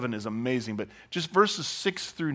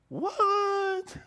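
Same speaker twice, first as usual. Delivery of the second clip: high, incredulous sarcastic "What?". Retold or imitated. Nicodemus answers Jesus, sarcastic high disbelief. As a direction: scoff in disbelief. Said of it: "Insert sarcasm".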